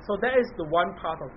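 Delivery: normal talking voice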